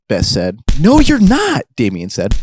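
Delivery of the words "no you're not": high, loud protest; panicked contradiction. Acted. Damien contradicts Best mid-struggle, panicked. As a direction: cry out in protest, pitched way up.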